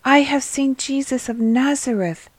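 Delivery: excited shepherd announcing Jesus. Acted. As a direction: blurt out the great news. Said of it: "You are excited".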